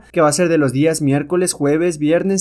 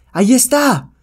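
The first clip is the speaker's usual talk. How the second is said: high, loud cry of discovery; a guard shouting that he has found the hero; 10 semitones higher, 5 dB louder, slower than usual. Acted. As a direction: yell it out as you spot him. Pursuers spot Calimán.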